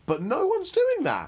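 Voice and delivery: high, drawn-out exasperated protest. Real, not acted. Speaker rants to co-host about unfair critics, exasperated. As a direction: push it up high, incredulous and insistent.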